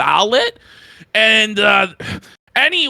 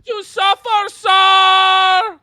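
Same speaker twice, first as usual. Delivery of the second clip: fluttering cries for help; 8 semitones higher, 3 dB louder, slower than usual. Pretend. Caricature voice continues.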